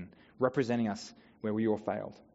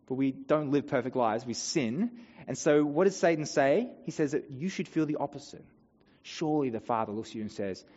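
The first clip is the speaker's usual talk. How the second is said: usual speaking voice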